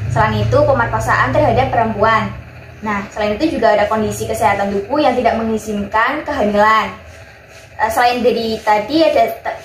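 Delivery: usual speaking voice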